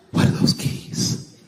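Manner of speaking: slow, whispered question; imitated tearful wife. Wife asking her husband about unfamiliar keys, hushed and tearful.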